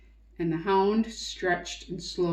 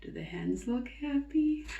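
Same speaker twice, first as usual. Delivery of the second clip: hushed question; 5 semitones higher, 6 dB quieter than usual. Real, not acted.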